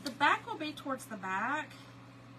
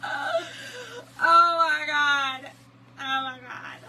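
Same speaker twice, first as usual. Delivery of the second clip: laughing; 5 semitones higher, 10 dB louder, much slower than usual. Retold or imitated. Cousin laughing hysterically.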